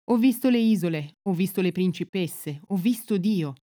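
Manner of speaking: ordinary delivery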